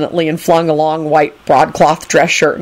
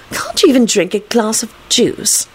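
higher-pitched scornful character voice; mildly disdainful, pitched up; 5 semitones higher than usual. A narrator's reading. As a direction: ask it with scorn. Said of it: "Rosie asked scornfully".

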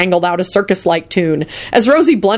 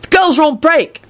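higher, offhand call; brisk raised-pitch aside; called over shoulder; 9 semitones higher than usual. A narrator's reading. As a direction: call back an explanation. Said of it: "he called over his shoulder".

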